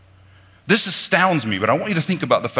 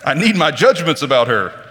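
higher, louder imitation of a judgmental listener; hammed-up self-righteous churchgoer; mock congregation voice; 5 semitones higher, 5 dB louder than usual. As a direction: play the eager gossip hungry for scandal. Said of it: "because you're like".

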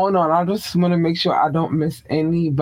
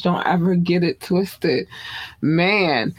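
ordinary talk, nothing marked

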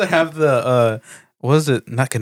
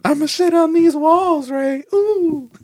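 much higher, squealing crude voice; raunchy character voice; 12 semitones higher, slower than usual. Pretend. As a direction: squeal it out like a trashy movie villain. Acting a gross movie character.

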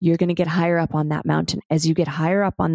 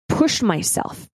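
ordinary delivery

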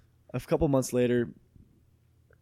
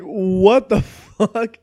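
loud, very drawn-out disbelief; high, slow, hammed-up bewilderment; incredulous outburst; 8 semitones higher, 12 dB louder, much slower than usual. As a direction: stretch it out in retold exasperation. Retold disbelief at party ending.

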